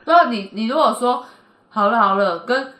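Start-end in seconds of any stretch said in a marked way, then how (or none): none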